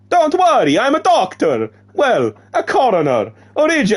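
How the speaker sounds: high, loud cartoon Italian accent; Mario. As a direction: play the doctor with a bouncy Italian accent. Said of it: "I've got Mario now".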